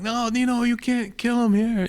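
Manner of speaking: high, stretched-out mock plea; urgent pleading. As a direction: imitate a father begging his brother to stop. Father pleads with uncle to stop.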